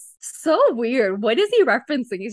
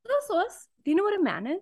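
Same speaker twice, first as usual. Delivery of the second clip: higher and softer, coaxing question; gentler inquisitive voice; 8 semitones higher, 6 dB quieter, faster than usual. Pretend. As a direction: ask it sweetly, not scary. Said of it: "No one's gonna wanna answer".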